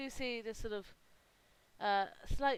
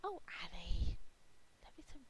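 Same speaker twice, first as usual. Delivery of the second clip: playful unvoiced tease, slowing on stiff; teasing girl to her stiff friend, whispered playfully. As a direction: whisper a fond tease at the end. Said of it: "slap on the arm, go".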